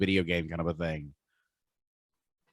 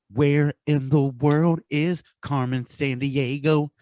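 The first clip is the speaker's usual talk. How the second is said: slow, higher announcer voice for the title; show title announcer; 6 semitones higher, slower than usual. Pretend.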